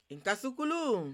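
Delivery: loud, high chanted call-out; ringing call, high then falling; storyteller's call. Shown as a demonstration. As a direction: call out to the audience for their response. Storyteller's call for audience response.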